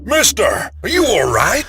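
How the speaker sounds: high, loud call; shouted question. A narrator's reading. Big man at the car window, high urgent shout. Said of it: "shouted the big".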